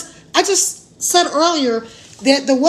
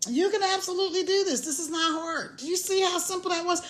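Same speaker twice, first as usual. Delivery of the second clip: soft encouragement; 4 semitones higher, 9 dB quieter than usual. Real, not acted. Soft encouragement after laughing.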